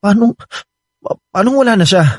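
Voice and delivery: high, stunned stammer; shocked disbelief. Acted. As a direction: stumble over the words in shock. Ex hears the baby was lost.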